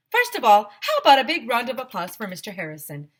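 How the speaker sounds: high, loud stage voice; rally the crowd; vice principal addressing kids. Acted. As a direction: enthusiastic school announcer.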